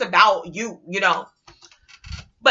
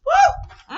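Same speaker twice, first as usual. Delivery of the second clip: loud, very high "woo"; high whoop; 4 dB louder than usual. Real, not acted. After seeing right through shenanigans, high loud whoop. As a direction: let out a sudden high-pitched whoop.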